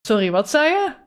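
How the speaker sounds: high, puzzled "sorry, what did you say?"; confused Dutch ask-again. Retold or imitated. To a dialect speaker she can't follow, high and confused. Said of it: "I am like".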